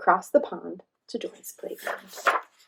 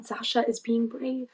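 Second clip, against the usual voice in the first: usual speaking voice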